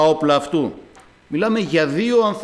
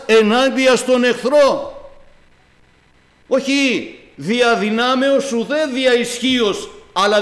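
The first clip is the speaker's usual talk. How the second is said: raised, pressing pulpit voice; fiery preaching; 4 semitones higher than usual. Acted. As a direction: exhort the flock to stand up and speak. Preacher exhorts the congregation. (κήρυγμα με πάθος και ένταση)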